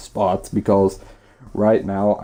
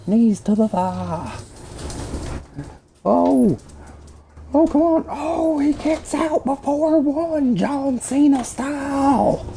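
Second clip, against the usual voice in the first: high-pitched excited play-by-play; wrestling commentator; 13 semitones higher than usual. Pretend. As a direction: call the match like a hyped announcer. Mock play-by-play over match footage.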